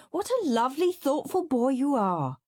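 high, gushing motherly praise; beaming. A narrator's reading. Mum praising perfect Peter, beaming. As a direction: coo it with a proud smile.

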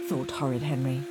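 usual speaking voice

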